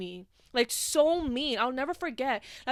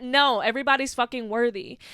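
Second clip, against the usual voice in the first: usual speaking voice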